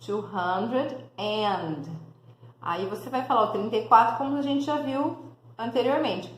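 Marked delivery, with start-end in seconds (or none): 0.1-1.8 "Two hundred and" very slowly with "and" clear; slow model phrase. Shown as a demonstration. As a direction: drag "Two hundred and" out so the "and" is heard. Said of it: "tem que colocar o and".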